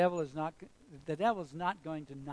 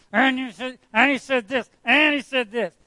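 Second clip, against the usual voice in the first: higher, louder accusing voice; preacher voicing the council accusing Stephen, loud and higher; mocking accusers; 7 semitones higher, 18 dB louder than usual. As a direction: fire the accusations like an angry council. Accusers hurling charges at Stephen.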